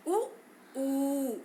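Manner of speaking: उ, ऊ with rounded lips; vowel demo. Shown as a demonstration. Teacher shows lip-rounded vowels to class. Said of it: "उ और ऊ का उच्चारण करते समय".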